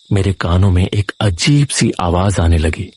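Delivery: ordinary delivery, nothing marked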